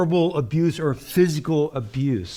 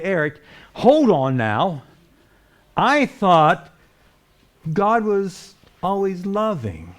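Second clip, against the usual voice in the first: raised, driving sermon voice; preaching at full force; 4 semitones higher, 3 dB louder than usual. Acted. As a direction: preach it out to the congregation.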